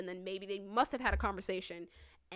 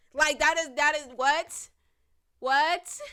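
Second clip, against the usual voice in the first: loud, high disbelieving outburst; sputtering start, then a loud rising question; incredulous outrage; 7 semitones higher, 12 dB louder, much slower than usual. Real, not acted. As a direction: blurt it out, voice leaping up in disbelief. Outraged reaction to the neighbor's defense.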